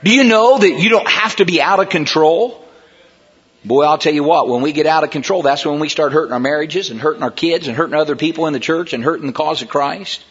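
normal talking voice

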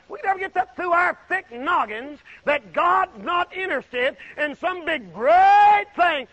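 rises high and ends in a shout; preacher hammering the point home; building preacher shout. Real, not acted. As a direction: build to a cry on great thing.

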